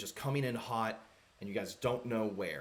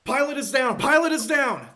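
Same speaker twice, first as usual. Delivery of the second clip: high, loud crash yell; panicked shout; 13 semitones higher, 12 dB louder than usual. Acted. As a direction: scream it as the ship goes down. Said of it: "lands directly into there".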